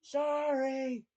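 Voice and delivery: high, drawn-out cry of apology; mock scream. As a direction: call it out high and long, without real volume. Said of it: "as she screams".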